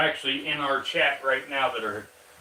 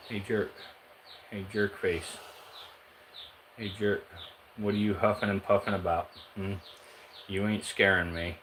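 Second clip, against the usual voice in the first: soft teasing; 2 semitones lower, 6 dB quieter than usual. Real, not acted. Streamer softly teases his hissing corn snake, hushed and playful. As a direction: needle the snake gently under your breath.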